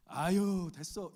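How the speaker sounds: loud exclaimed "아유" then a casual "됐어"; mock-brush off the offer of help; Daejeon-style polite refusal. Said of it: "이거 거절 아닙니다". (도와주겠다는 말에 대전식으로 사양하는 흉내)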